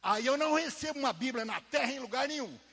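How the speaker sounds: slow, loud, fervent proclamation; shouted preaching. Acted. Preacher marvelling at God's plan before the church, shouting. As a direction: cry it out like a revival preacher.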